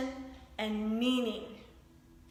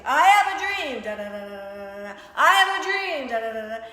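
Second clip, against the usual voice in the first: Martin Luther King; 10 dB louder than usual. Retold or imitated. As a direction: proclaim it like a preacher at a rally. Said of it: "like Martin Luther King".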